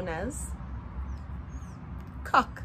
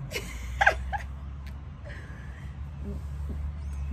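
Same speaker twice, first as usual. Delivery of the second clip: high unvoiced wheezing laugh; 3 dB quieter than usual. Real, not acted. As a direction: crack up laughing helplessly. Laughing at funny word.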